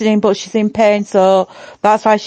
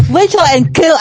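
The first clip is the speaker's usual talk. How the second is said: normal talking voice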